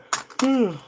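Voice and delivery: big sighing yawn. Real, not acted. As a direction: let out a long yawn. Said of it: "Got a yawn".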